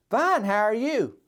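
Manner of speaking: "Fine" lifted high and bright, carefree; cheerful reply. Shown as a demonstration. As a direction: answer like everything is truly fine. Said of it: "but the way they say it".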